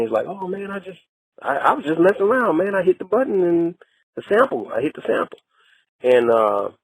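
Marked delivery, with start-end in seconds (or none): 0.3-5.4 higher, lightly voiced excuse; imitating laughing friend. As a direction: play it high and offhand, brushing it off. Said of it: "and he's laughing".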